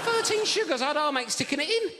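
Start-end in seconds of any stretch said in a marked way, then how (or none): 0.0-0.8 much higher, disbelieving Thirteen sugars; incredulous. Retold or imitated. Comedian echoing the builder's sugar order, incredulous high pitch. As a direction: squeak "Thirteen" in outrage.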